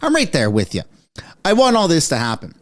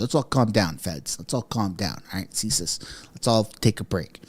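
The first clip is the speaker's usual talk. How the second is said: mock hush to the feds; 11 semitones lower, 10 dB quieter than usual. Mock addressing feds and CSIS.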